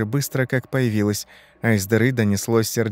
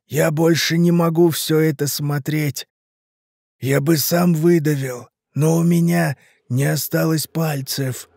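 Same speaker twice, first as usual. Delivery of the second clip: higher, slow, strained plea; anguished dying man; 5 semitones higher than usual. Acted. Rotting man explains his helplessness, anguished. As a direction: drag the words out in torment.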